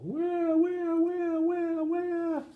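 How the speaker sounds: put-on very high baby wail of wah; high wah-wah crying; yelling baby. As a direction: bawl as loud as you can. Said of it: "the baby was yelling as loudly as possible".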